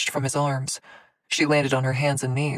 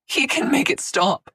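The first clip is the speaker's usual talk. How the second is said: high, loud sobbing plea; 11 semitones higher, 5 dB louder than usual. Acted. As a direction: keep sobbing, begging. Scarlet sobbing plea continues.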